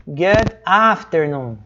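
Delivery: good afternoon slow and drawn out, word by word; devagar e pausado. Shown as a demonstration. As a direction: say it slowly for learners. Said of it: "eu tô só devagar aqui". (bem devagar, palavra por palavra)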